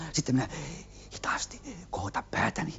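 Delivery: hushed whisper while sneaking. Retold or imitated. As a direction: whisper it so nothing rustles. Sneaking toward the light.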